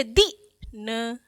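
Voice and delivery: दी न split and said very slowly; careful slow spelling; slow sound-out. Shown as a demonstration. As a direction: sound out दिन syllable by syllable. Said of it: "तो इसको धीरे से पढ़ रहे थे".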